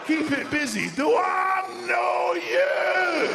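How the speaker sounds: slow, high, bellowed Scottish fury; mental Scottish rant. Acted. Woken homeowner raging at intruder, deranged Scottish bellow. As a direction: go mental, drawing out each shout in Scots. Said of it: "a completely naked Scottish man".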